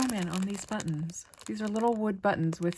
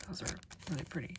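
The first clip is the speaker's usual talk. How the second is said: usual speaking voice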